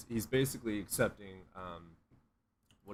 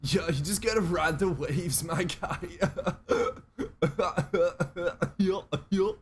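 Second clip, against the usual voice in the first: stoner guru voice; 6 semitones higher than usual. Retold or imitated. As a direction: preach cosmic wisdom like a stoned bro. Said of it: "I used to talk like that".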